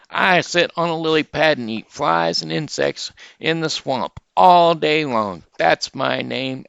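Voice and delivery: high, loud, booming big-mouth frog; high, loud frog shouting hello to the rabbit. Acted. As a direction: shout the greeting like a proud frog. Frog greets pigs.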